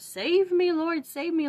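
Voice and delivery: high, loud desperate plea; crying out for help. Retold or imitated. Isaac crying out on the altar, high and loud desperate plea. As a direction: beg the Lord to save you. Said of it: "Isaac is crying out".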